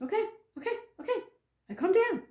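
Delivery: acted-out quick, high agreement; hurried compliance. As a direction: give in quickly, pitched high. Told to calm down, complying.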